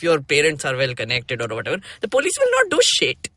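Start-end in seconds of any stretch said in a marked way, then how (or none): 2.0-3.3 high, scornful jab at the police. Real, not acted. Scorning police who protect the privileged, contemptuous. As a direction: spit out the contempt.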